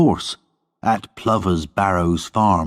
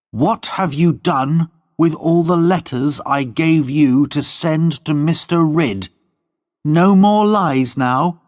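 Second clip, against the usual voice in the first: put-on higher, demanding young woman; stern Lorna; 9 semitones higher, 4 dB louder than usual. A narrator's reading. Lorna questioning her servant about the letters, stern. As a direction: press for the truth.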